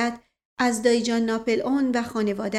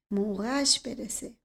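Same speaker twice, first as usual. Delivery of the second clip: softer, slow, more inward second «موقعش برسه», falling at the end; muttered under breath; 6 dB quieter than usual. Acted. Uncle mulls the words over, muttering softer still. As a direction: let «موقعش برسه» sink away slowly to yourself. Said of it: "زیر لب کلمات او را تکرار کرد".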